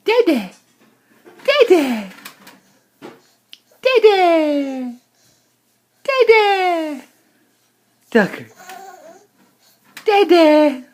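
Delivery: high loud calls of dede; excited calls. Real, not acted. Parent cheers the baby to say dede, loud and high.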